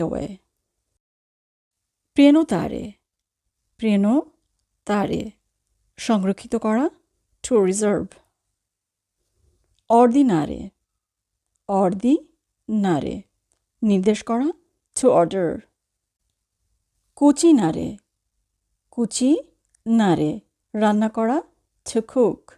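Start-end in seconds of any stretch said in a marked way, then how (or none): 3.8-5.2 prenotare slowly in two parts, "preno... tare"; teacher's slow breakdown of prenotare, preno, then tare; slow, split word. Shown as a demonstration. 11.7-13.2 ordinare slowly in two parts, "ordi... nare"; teacher's slow breakdown of ordinare, ordi, then nare; slow, split word. Shown as a demonstration. 18.9-20.3 cucinare slowly in two parts, "cuci... nare"; teacher's slow breakdown of cucinare, cuci, then nare; slow, split word. Shown as a demonstration.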